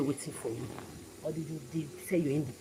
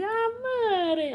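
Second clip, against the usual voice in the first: high, slow, drawn-out fake welcome; mock sweet greeting; 9 dB louder, much slower than usual. Mocking a hypocrite greeting Mary too sweetly. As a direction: sing out the name like a fake friend. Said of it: "you say".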